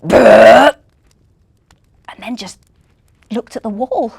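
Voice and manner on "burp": loud belching burp; big burp. Acted. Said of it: "He let out a big".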